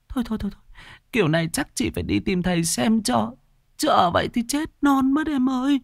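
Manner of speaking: very high, quavering wail of fear; panicky, tearful-sounding begging for help; terrified insistence. Acted. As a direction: plead desperately, still haunted by the night. Terrified boss insists ghost is real.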